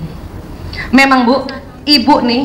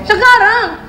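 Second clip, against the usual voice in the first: put-on whining child; 7 semitones higher, slower than usual. Shown as a demonstration. Child insisting.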